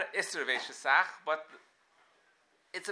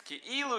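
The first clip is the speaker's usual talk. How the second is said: Ki'ilu pitched way up; stress on Ki'ilu; 9 semitones higher than usual. Shown as a demonstration. Teacher showing students where the emphasis falls, stressed Ki'ilu. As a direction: lean on Ki'ilu in a high, drawn-out push. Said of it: "put emphasis on the Ki'ilu".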